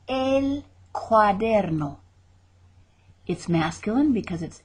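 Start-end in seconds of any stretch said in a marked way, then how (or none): 0.1-2.0 El cuaderno spelled out slowly, each syllable; slow repeat. Shown as a demonstration. Teacher repeats El cuaderno slowly so students copy. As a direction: drag El cuaderno out slowly for learners. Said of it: "model then slow repeat".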